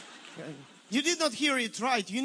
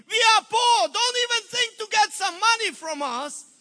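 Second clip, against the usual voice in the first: loud, high protest of poor people; indignant protest; 8 semitones higher, 6 dB louder than usual. Retold or imitated. Poor churchgoers refusing the pastor's offering talk, loud protest. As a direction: push the money talk away, loudly.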